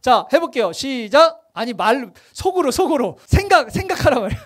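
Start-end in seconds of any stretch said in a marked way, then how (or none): none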